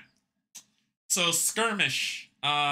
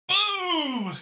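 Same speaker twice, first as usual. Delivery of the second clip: triumphant boom; 8 semitones higher than usual. Real, not acted. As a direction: slam it down like a winner. [name] wins the game.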